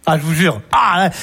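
ordinary delivery

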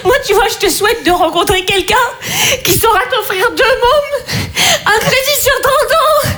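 monte de plus en plus haut en riant et pleurant; elle craque, mi-rire mi-sanglot; rire qui vire aux larmes. Acted. (la sœur épuisée craque devant sa cadette, en riant et pleurant)

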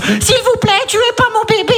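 high begging voice; hammed-up frightened plea; pleading victim. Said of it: "film de flic russe".

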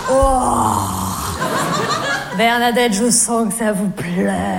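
low, slowing seductive man; Jean-Loup. Retold or imitated. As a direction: croon low, convinced she enjoys it. Voicing Jean-Loup, mistaking cries for pleasure. (voix grave de séducteur)

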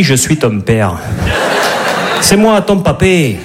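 put-on villain speaking with a southern French accent; Dark Vador, accent du Midi. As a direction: make the dark lord sound like a Midi granddad. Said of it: "T'imagines Dark Vador avec l'accent du Midi?". (méchant de film avec l'accent du Midi)

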